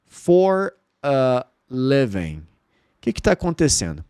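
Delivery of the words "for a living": for a living said slowly, living as levem; slow stretched. Shown as a demonstration. Teacher isolating the second part slowly. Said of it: "Agora aqui".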